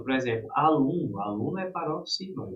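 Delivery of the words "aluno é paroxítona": usual speaking voice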